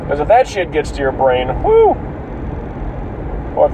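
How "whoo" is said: high yelp; whoo. Retold or imitated. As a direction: yelp as if it burns. Imagining snorting garlic.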